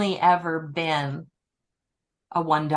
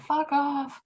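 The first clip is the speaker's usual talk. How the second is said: high-pitched mock cat voice; cat swearing; 10 semitones higher than usual. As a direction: curse like a disgruntled old cat. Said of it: "hear her saying".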